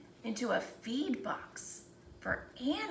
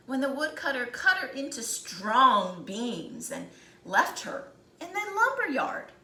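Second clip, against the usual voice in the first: usual speaking voice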